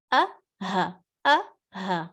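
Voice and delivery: throat sound demo; breathy letter sounds. Shown as a demonstration. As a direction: demo the bare hamza and ha sounds. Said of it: "حمزہ اور ہا کی آواز".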